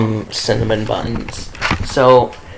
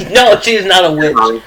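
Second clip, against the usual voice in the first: laughing; 6 semitones higher, 4 dB louder than usual. Real, not acted. Laughing denial.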